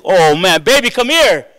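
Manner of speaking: high, loud excited call; excited calling wife. Retold or imitated. Husband calling his wife to watch the fight, excited. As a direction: call the wife over, thrilled. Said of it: "I'm like".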